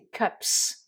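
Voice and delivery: "cups" ending in a crisp s; English cups. Shown as a demonstration. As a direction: end "cups" on a hissed s. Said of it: "c'est le son se".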